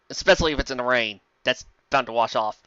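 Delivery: usual speaking voice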